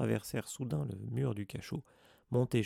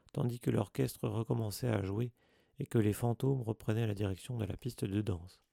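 ordinary delivery, nothing marked

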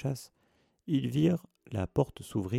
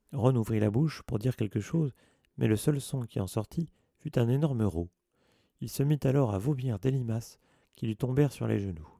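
plain reading voice

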